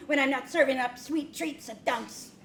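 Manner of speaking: normal talking voice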